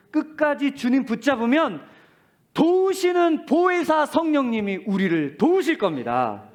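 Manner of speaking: high, loud, slow proclamation; fervent climactic preaching; preaching at full force. Acted. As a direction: preach it to the back row.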